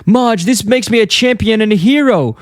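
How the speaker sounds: high, put-on cartoon husband voice; Homer. Husband boasts to his wife about the fish, mock triumphant. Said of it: "He goes".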